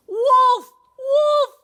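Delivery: high, loud cry of fire; alarm shout. Acted. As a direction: yell the alarm to scare the birds. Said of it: "he cried".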